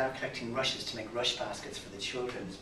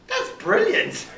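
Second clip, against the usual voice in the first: delighted laughing exclamation; 9 dB louder, slower than usual. Acted. Fairy king delighted.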